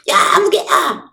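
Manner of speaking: high, drawn-out shout into the phone; mock angry phone outburst. Shown as a demonstration. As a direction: call out the friend's name in pretend fury. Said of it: "통화하듯이 퍼붓는 거예요". (전화에 대고 화난 척 길게 외치기)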